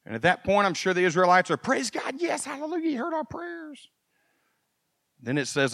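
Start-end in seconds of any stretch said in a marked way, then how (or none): 1.6-3.9 high, soft mock hallelujah; mock rejoicing. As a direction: gush like a crowd thrilled to be fed. Israelites rejoicing at meat promise.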